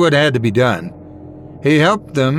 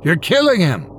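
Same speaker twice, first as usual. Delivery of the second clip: higher-pitched alarmed protest; crying out; 5 semitones higher than usual. A narrator's reading. Friend watches the choking, crying out in alarm. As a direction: plead in a raised, urgent voice. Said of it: "Michael cried".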